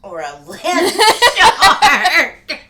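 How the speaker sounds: laughing through the words, slow; cracking up mid-phrase, high and loud. Real, not acted. Repeating her co-host's land shark joke, laughing.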